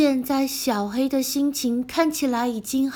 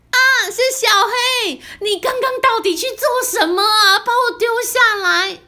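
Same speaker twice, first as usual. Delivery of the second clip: reproachful; 8 semitones higher, 3 dB louder than usual. Acted. Reproaching friend.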